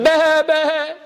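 high, pleading little bleat; fearful lamb bleat. Acted. Scared lamb running to its mother, high fearful bleat. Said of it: "so scared and fearful".

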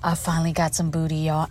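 usual speaking voice

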